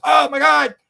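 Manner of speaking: preacher sees the devil, voice jumping up; frightened preacher. Retold or imitated. Former preacher under hypnosis sees devil, alarmed and high. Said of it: "And the guy goes".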